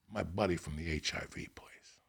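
low, hushed punchline; whispered reveal. Real, not acted. Telling the host who showed up, whispered. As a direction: drop to a secretive whisper.

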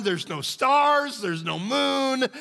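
slow, high storyteller voice; preacher building an eerie scene, slowly; slow dramatic telling. Acted. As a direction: draw each phrase out for suspense.

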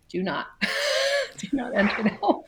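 giggling through a playful do-not-enter order; half-laughing, mock-stern house rule; laughing mock warning. Mom laughing as she warns her kids away, giggling.

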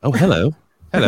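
playful British hello; British accent. Pretend. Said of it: "You do the British accent".

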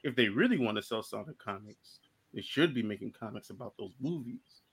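low, soft growling tiger voice; Tiger Claw voice. Retold or imitated. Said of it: "Should I do my Tiger Claw impression?".

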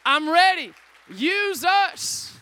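loud, rallying cry; congregation's loud shout of readiness; shout of praise. Pretend. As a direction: shout it out to God. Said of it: "give him a big shout of praise".

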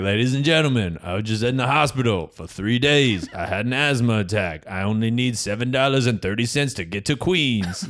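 imitated panhandler's rehearsed spiel; panhandler's pitch. Panhandler addressing passengers for money, rehearsed pitch. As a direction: recite it like a practised bus beggar. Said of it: "his speech is like".

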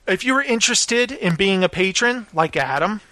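usual speaking voice

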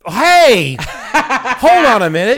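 loud laughing "hey, hold on"; laughing protest. Real, not acted. Mock outrage while laughing.

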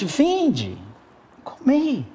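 high, drawn-out invitation without shouting; solemn acted summons, pitched high; calling out. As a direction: call them over to the meal, acted. Jesus calls disciples to eat.

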